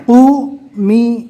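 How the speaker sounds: قومی drawn out very slowly with a rising end; slow demo. Shown as a demonstration. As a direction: pull قومی out long, rounding قو. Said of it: "آواز کو تھوڑا دبا کر زیر پڑھنا ہے".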